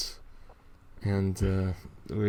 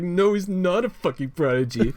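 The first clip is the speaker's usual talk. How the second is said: mock villain rant; 9 semitones higher, 3 dB louder than usual. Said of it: "where it's like".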